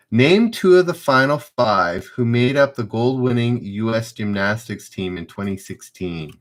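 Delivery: ordinary delivery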